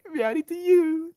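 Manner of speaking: high, slow cutesy greeting; sing-song high meowdy; cutesy squeak. Pretend. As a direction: squeak it out like a cartoon kitten.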